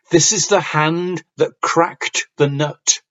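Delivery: slow, sounding the final consonants of "cracked" and "nut"; clear diction. Shown as a demonstration. Teacher demonstrating diction with a poem line, clear word endings on "cracked" and "nut". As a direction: crisply finish the ends of "cracked" and "nut". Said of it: "remember to sound the ends of the words".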